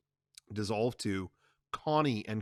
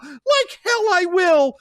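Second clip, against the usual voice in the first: much higher, loud, slow; defiant shout; 12 dB louder, slower than usual. Acted. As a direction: defy him flatly. Connie defies.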